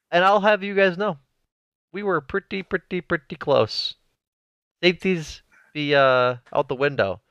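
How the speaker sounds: high, hyped-up ring announcer boast; wrestling promo. Pretend. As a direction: boast like a pro wrestler on the mic. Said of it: "I'm doing a wrestling promo".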